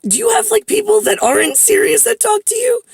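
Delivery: very high-pitched incredulous query; baffled question. Retold or imitated. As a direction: retell bewilderment in a squeaky-high voice. Retelling her baffled question to him.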